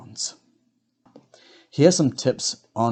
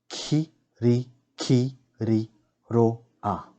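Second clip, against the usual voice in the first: "Kiri, kiri, roa" with every syllable apart: ki, ri, ki, ri, ro, a; slow syllable-by-syllable demo; broken into syllables; much slower than usual. Shown as a demonstration. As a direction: sound out six separate syllables. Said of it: "break down the words first".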